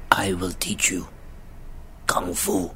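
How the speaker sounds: sexy mentor voice. Retold or imitated. Imitating the mentor's line to Jaden, in a sexy low voice. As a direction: purr it low like a seductive kung fu master. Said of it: "I'm gonna say this to the mic so it sounds sexy".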